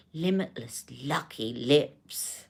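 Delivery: low, slow, hushed impression; soft, lingering mock sales purr; Charlotte. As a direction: draw out each word like a luxury-brand founder. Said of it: "do it in her accent".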